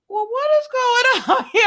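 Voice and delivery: shocked, half laughing at the nerve; baffled outburst. Retold or imitated. Nika baffled by Miss Wu.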